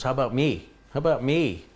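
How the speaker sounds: pushy pet asking to be noticed; lightly voiced needy dog; voicing the dog. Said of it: "He goes".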